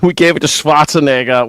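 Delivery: ordinary talk, nothing marked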